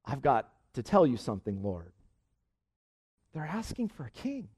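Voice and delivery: low, hushed report to the Lord; troubled. Acted. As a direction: break hard news softly, as if reluctant. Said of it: "Samuel was so troubled by this".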